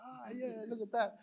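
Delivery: high, soft imitation of curious locals; admiring onlookers. Residents bringing kids to watch the burn, imitated high wonder.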